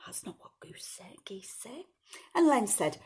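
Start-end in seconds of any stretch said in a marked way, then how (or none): 0.0-1.9 very soft whisper; hushed confiding aside. Real, not acted. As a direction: slip it to the listener under your breath. Whispered aside to the child listener.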